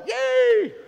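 high loud yay; mock cheer. Preacher imitating the congregation cheering. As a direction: cheer like the whole crowd. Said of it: "And we're all".

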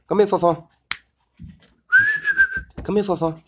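calling the dogs. Real, not acted. Owner calls his dogs to come, loud high call. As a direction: call them in from across the yard.

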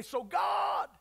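high, drawn-out lead-in; call-and-response cue. Shown as a demonstration. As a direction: call it out for the congregation to answer. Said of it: "and I'm gonna say".

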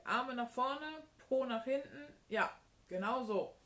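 high, loud exercise instructions; commanding. A narrator's reading. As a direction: call out each move like a coach. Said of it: "kommandierte sie". (laut und munter kommandiert)

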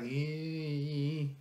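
long drawn-out, hesitant "ehhh"; reluctant "eh". Retold or imitated. Reviewer imitates buyers put off by the price, reluctant. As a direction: imitate someone balking with a drawn-out noise. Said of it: "people might see, go like".

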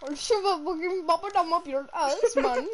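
playful threat in a put-on Indian accent; mock Indian accent. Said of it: "my Indian voice".

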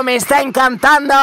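normal talking voice

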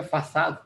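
"fasad" with an s sound, "fa-SAD"; "fasad" ending on "ahd"; alternative pronunciation. Shown as a demonstration. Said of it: "Hay gente que pronuncia fasad".

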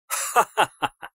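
a loud, open ha-ha-ha; hearty laugh. Acted. Brother mocks the doctors who could never do such a murder, loud laugh. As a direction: laugh out mockingly at the bumbling doctors.

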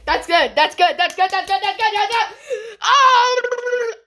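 overexcited, pitch rising to a squeal; giddy excitement. Real, not acted. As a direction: babble with joy, then burst out. Overjoyed at the big pull.

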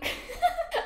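high burst of laughter. Real, not acted.

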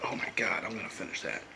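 breathy awed whisper; hushed delight at the last sip. Real, not acted. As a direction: whisper it in disbelief. Savoring beer, hushed aside.

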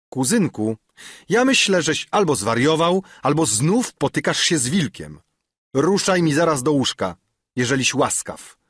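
wyższy, głośniejszy, gderliwy głos starca; zrzędliwy dziadek. A narrator's reading. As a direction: ofuknij wnuka jak stary dziadek. Dziadek budzi wnuka. (dziadek strofuje wnuka w nocy, gderliwie)

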